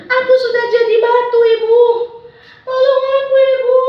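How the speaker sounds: high, loud wailing for mother; crying plea. Acted. Darmi turning to stone wails to her mother, high crying. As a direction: sob out tolong aku. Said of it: "sambil dia nangis".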